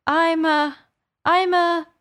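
high, halting false starts; faltering. A narrator's reading. Alice explaining herself to pigeon, faltering. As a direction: search for the answer and break off.

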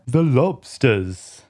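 low, loud, slow Griffin shout. Acted. Griffin bounds into the air and shouts the word, low and loud. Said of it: "shouted the Griffin".

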